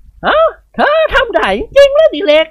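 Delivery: put-on high, slow astonished old lady; light up at sudden hope; hopeful surprise. Khun Ying Wad amazed that Dr. Dilek could revive her dog.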